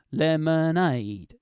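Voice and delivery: slow, stretched lemonade with a long final aid; drawn-out lemonade. Shown as a demonstration. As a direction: stretch the last syllable of lemonade. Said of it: "See that?".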